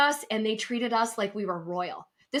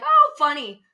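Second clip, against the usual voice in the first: mock amusement; 5 semitones higher, 8 dB louder than usual. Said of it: "and I'm like".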